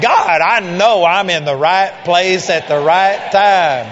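loud, slow, elated prayer cry; joyful excitement. Retold or imitated. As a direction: pour out joy to God. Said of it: "you talk about joy and excitement".

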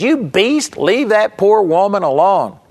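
high-pitched mock outcry; retold yell. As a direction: imitate an indignant heckler yelling out. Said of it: "he yelled out".

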